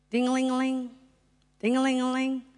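sing-song mimicked phone ringing; phone ring imitation. Speaker mimics the phone ringing before a call, sing-song.

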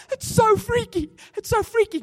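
high and loud, astonished; imitating a stunned farmhand; amazed. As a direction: imitate the farmhand, stunned his arm lifts again. Healed man amazed at his arm.

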